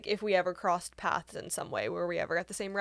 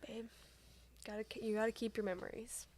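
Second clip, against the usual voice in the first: soft unvoiced whisper; 2 semitones higher, 11 dB quieter than usual. Real, not acted. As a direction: whisper it close. Whispered banter.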